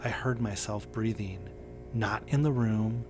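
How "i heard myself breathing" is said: ordinary delivery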